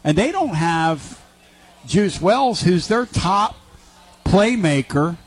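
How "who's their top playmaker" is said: ordinary talk, nothing marked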